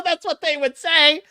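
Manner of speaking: normal talking voice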